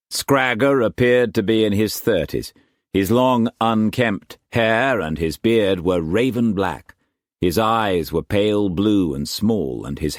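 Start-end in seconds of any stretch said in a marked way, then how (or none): none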